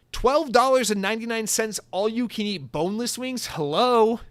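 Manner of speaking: high, loud and thrilled; excited deal announcement. Real, not acted. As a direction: build to an incredulous, punched "Hello". Gleefully announcing a restaurant deal.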